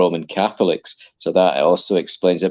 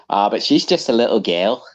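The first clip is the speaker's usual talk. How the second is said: mock Scouse accent; 2 semitones higher than usual. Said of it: "a Liverpudlian guy said".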